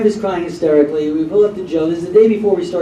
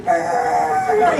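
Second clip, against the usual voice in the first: mock roar. Peeling out in the car.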